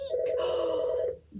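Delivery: sharp surprised gasp; a quick breathless gasp of excitement. Acted. Reader gasps in story.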